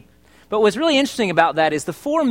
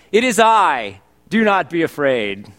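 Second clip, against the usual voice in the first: mock proclamation; 4 dB louder, slower than usual.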